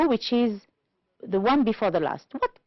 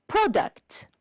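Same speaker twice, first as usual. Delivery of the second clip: "product" with PRO high and stressed; stress on first syllable; 9 semitones higher than usual. Shown as a demonstration. Teacher listing words for the table, first syllable of "product" stressed. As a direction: pitch up the start of "product". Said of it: "first syllable".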